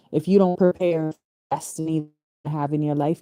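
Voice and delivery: ordinary talk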